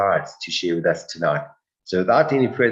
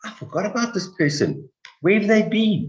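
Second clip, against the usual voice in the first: quick surprised recall rising higher; mock surprise, voice climbing higher; 10 semitones higher than usual. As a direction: pretend to suddenly remember someone, rising high. Imagined self, surprised.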